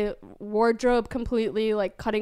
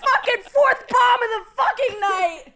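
mock comedian rant; 12 semitones higher, 5 dB louder than usual. Acting out comedian bombing.

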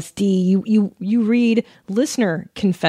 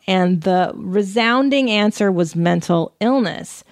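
normal talking voice

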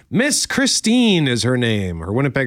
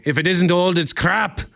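a light, hammed-up Scottish accent, higher; terrible Scottish accent; 6 semitones higher than usual. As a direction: toss it off in a bad Scottish brogue. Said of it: "that's a terrible Scottish accent".

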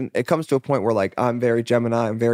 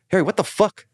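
higher, insistent dad impression; exasperated dad; 8 semitones higher than usual. Dad urging his kid to sing, mock exasperation. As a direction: imitate a dad pushing his kid, pitched up. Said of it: "He's like".

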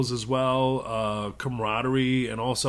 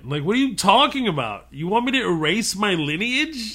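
incredulous; 8 semitones higher, 4 dB louder than usual. Real, not acted. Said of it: "reacting with disbelief".

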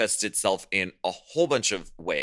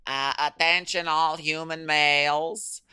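high, robotic alien announcement; alien announcer voice; 8 semitones higher, 3 dB louder than usual. Retold or imitated. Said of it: "Disasterino was running around saying".

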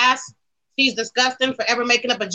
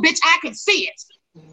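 ordinary talk

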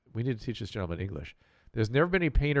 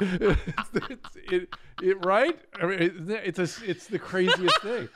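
laughing; 7 semitones higher, slower than usual. Real, not acted. Laughing at the king video.

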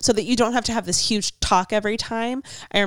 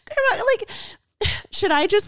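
very high-pitched retold protest; mock-baffled reply; 14 semitones higher than usual. Granddaughter questions grandma's remark, mock-baffled high voice.

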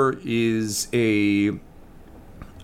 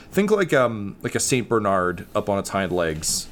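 ordinary delivery

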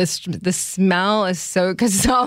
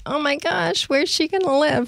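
gushing over cat; 9 semitones higher than usual. Retold or imitated. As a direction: melt over the cat in a high voice. Retelling swooning over the cat.

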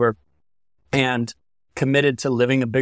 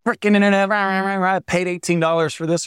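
mock-rant at the internet, pitched up; mock frustration; 6 semitones higher than usual. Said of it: "At first I got frustrated. I was like".

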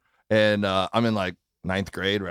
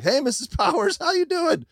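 put-on much higher, chipper teenage hello; cheery kid greeting. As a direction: greet a friend's mom brightly. Greeting his buddy's mom cheerfully.

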